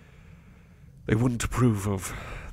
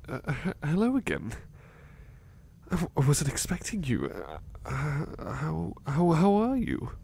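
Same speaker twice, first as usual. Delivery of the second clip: flustered surprise; 6 semitones higher than usual. Acted. Startled by a visitor.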